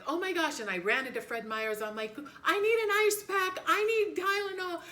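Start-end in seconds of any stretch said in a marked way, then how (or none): 0.1-0.6 panicked. Retold or imitated. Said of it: "And I was like". 2.4-4.8 much higher and louder, frantic; freaking out. Retold or imitated. Daughter frantically asking store staff for help, much higher and louder. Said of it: "I was just freaking out".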